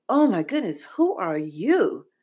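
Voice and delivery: lightly acted wide-eyed child; awed inner child. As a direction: imitate a nine-year-old, drawing out the question. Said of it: "my inner child ... like, nine".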